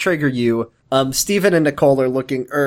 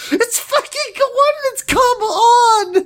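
frustrated scream, much higher; slower than usual. Real, not acted. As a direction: scream it at the screen in disbelief. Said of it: "I'm just screaming at the top of my lungs".

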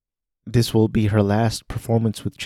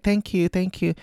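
much higher, softer idol voice; sweet idol thanks; 9 semitones higher, 3 dB quieter than usual. Retold or imitated. Mima thanking fans on the way to the limousine, sweet high voice. And she's like.

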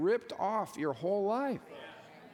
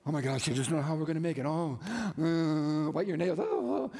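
mock freaking out; 3 semitones lower than usual. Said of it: "freaking out".

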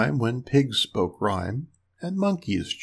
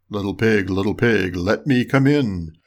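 low wolf voice, drawing out the last words; wolf at the door; 6 semitones lower than usual. A narrator's reading. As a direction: call the pig in a lower storybook wolf voice.

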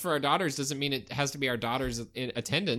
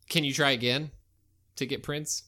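usual speaking voice

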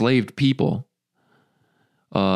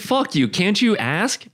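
much higher, indignant, slow on the ask; mock indignant; 10 semitones higher than usual. Mock outrage at the robot, high and slow.

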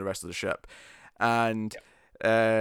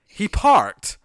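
much higher and louder, incredulous rant; mock disbelief; 8 semitones higher, 6 dB louder than usual. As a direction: act baffled that anyone praises parking. Mocking Picard praising the parking.

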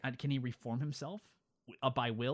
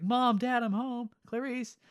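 high-pitched mock homecoming call; 9 semitones higher, 3 dB louder, slower than usual. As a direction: call out high to the family, hammed up. Rudolph arriving home, calls family.

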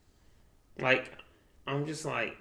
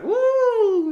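loud, high "woo"; excited whoop; 11 dB louder than usual. Retold or imitated. Retelling his reaction to a movie fight, excited whoop. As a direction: let out a long high whoop.

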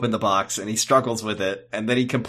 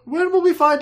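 high silly voice; 6 dB louder, faster than usual. Acted. Echoing the comic voice.

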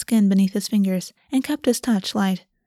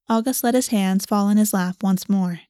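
ordinary delivery

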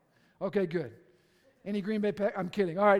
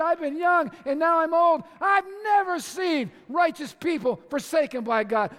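shouted proclamation; 8 semitones higher, 8 dB louder than usual. Acted. Preacher shouting scripture to the congregation, full force. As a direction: preach it out loud and high.

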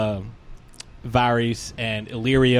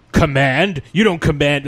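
indignant, louder; indignant outburst; 3 semitones higher, 6 dB louder than usual. Retold or imitated. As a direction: scoff at being commanded. Quoting Viserys's outrage.

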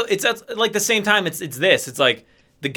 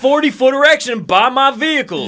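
higher, louder pitchman shout; Brad Benson impression; 7 semitones higher, 5 dB louder than usual. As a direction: hype it like a raunchy car dealer ad. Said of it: "Brad Benson".

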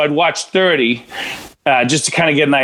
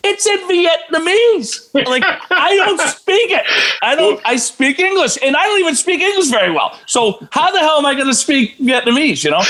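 exasperated protest; 12 semitones higher than usual. Retold or imitated. Answering critics who blamed him, high whiny exasperated protest. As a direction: plead your case in a high put-on whine.

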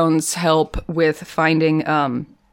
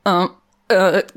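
halting ums, then a higher, louder awkward compliment; awkward stammer; 5 semitones higher, 5 dB louder than usual. Retold or imitated. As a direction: stumble awkwardly into mock small talk. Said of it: "him and Sherlock are like".

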